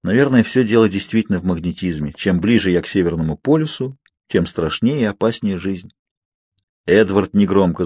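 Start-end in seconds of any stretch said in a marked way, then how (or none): none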